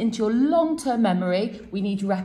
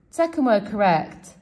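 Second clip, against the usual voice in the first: ordinary talk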